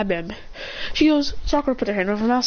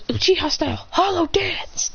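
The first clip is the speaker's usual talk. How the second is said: anime attack call; 6 semitones higher than usual. Acted. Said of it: "Then he goes".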